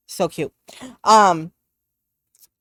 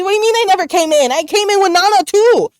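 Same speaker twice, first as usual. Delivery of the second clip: much higher, louder, fast protest; retold freak-out; 11 semitones higher, 6 dB louder, faster than usual. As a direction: blurt it out in high-pitched disbelief. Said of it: "I was freaking out".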